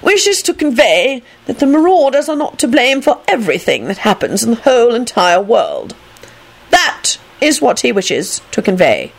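insistent, formal delivery starting high; importunate announcement. A narrator's reading. Phineas relaying a message to Minerva, importunate. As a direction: deliver the message pointedly, slowing at the end. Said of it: "Phineas announced importunately".

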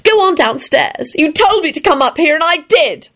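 high-pitched cross shriek; acted indignant high outburst; Elizabeth, shrieking. A narrator's reading. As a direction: snap back with pitch shooting up. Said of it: "she shrieked".

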